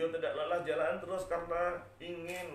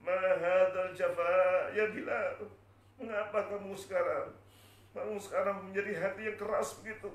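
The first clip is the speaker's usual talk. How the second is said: tearful reproach; 6 semitones higher, 4 dB louder than usual. Acted. Bilal retelling the Prophet's rebuke, pained reproach. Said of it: "saya ditegur".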